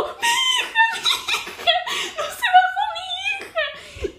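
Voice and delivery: high, loud, panicked mother shouting with laughter; hammed-up frantic mother cry; frantic mom yelling. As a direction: screech like a desperate mom running beside the bus.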